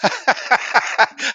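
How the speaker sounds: loud, high cackling laugh; amused laugh. Real, not acted. Caller joking about the agent at council, loud laugh. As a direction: crack up at your own joke.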